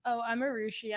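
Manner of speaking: normal talking voice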